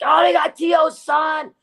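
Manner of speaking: high, loud, excited fan squeal; high overjoyed fan imitation; mock fan excitement. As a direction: cheer it like thrilled fans, slow. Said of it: "everybody went fucking ballistic".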